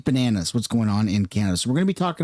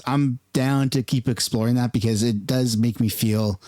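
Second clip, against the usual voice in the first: ordinary talk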